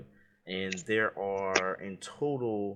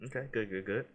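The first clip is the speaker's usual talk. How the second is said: ordinary talk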